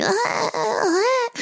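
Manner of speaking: put-on high whiny baby wail; baby fussing cry. Mom imitating her colicky baby put down, high fussing cry. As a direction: imitate a colicky newborn crying. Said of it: "she's like".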